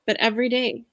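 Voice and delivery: ordinary talk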